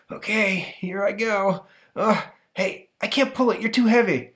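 put-on high, effortful kid; straining boy. Stanley trying to pull the crate, high and straining. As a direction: strain against the weight. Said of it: "boy straining to pull".

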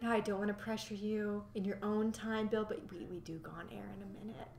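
gentle coaxing. Retold or imitated. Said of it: "when she's like".

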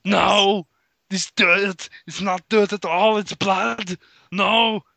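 high, hammed-up cries of dismay; distressed Arnold. Arnold realizing the dirt is blood, hammed-up distress. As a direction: wail it in a put-on character voice.

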